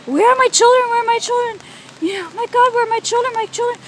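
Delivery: high, imitated screaming and wailing; retold wailing. Acting out her sister searching for her children, high retold wail. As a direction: act out a desperate, wailing cry. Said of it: "she was sobbing and wailing and just screaming".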